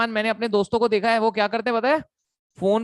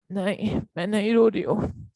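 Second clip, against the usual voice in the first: lightly hammed-up girlfriend pretending she isn't crying; mock sniffly denial on the phone; mock teary girlfriend. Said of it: "ऐसे रोने की acting करेगी लड़की".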